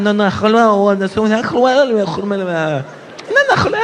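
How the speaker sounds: fast nonsense syllables in a put-on Arabic sound; mock Arabic babble. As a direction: rattle off fake Arabic like an overheard conversation. Mock Arabic gibberish chant.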